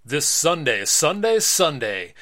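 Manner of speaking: booming promo voice, rising then falling; hype announcer. Acted. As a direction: sell it like a monster truck ad. Boy does monster-truck announcer hype.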